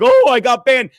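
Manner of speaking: loud mocking cry of grievance; mock outraged complaint. Mocking Chad to the co-host, loud mock outrage. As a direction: blurt it out loudly, as mock complaint. Mocks Chad's banned complaint.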